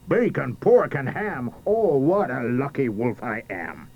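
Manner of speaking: mild storybook shout; gleeful wolf shout. A narrator's reading. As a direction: crow it out as a triumphant wolf. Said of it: "He shouted".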